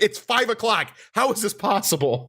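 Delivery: high, exasperated mock plea; incredulous high. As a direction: pitch up in disbelief. Retold outrage, out of chicken.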